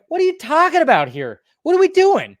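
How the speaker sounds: high-pitched outraged excitement; incredulous rant. Retold or imitated. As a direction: rant in disbelief at the rating. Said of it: "I remember just going like".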